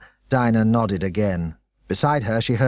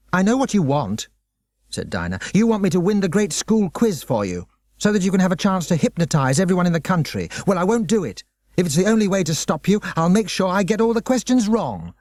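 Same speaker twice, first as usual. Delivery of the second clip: higher, quick and stubborn schoolgirl; defiant girl; 6 semitones higher than usual. Acted. Dinah refusing the headmaster, defiant and higher. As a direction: refuse him flat out, voice raised.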